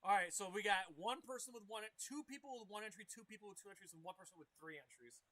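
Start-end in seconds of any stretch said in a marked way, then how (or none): none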